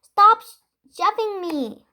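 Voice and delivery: high, loud squawking cry. A narrator's reading. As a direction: squawk at the pushing goblin.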